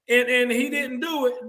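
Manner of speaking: usual speaking voice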